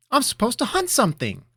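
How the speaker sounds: high, loud eager cry; excited interruption. Acted. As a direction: jump in bursting with an idea. Apprentice guessing again.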